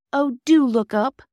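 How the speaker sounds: high, loud, drawn-out plea; desperate pleading. A narrator's reading. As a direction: beg with a stretched DO. Phyllis pleads with unconscious boy.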